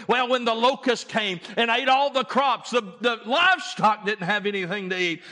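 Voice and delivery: high, loud reassuring proclamation; full-force preaching. Acted. God reassuring beasts and people, loud preaching. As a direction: thunder the promise over the suffering beasts.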